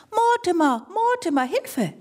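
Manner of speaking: small voice shouting Mortimer for help; tiny creature crying out in panic; calling for help. Acted. Squeaky cry for help.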